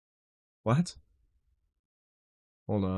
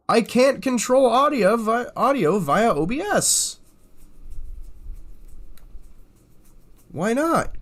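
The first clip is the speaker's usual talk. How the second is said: much higher, louder whiny frustration; exasperated complaint; 11 semitones higher, 4 dB louder than usual. Real, not acted. Streamer to chat, can't capture Discord audio, high loud exasperation. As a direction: plead with the software in disbelief.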